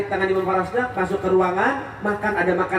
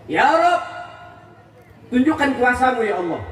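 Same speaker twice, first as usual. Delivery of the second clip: pleading prayer; 4 semitones higher than usual. Acted. Imam prays aloud in story.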